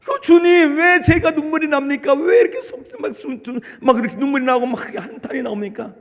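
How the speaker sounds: a touch of retold sobbing; tearful prayer. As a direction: cry out to the Lord through tears. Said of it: "눈물이 펑펑펑펑 쏟아지더라는 거예요".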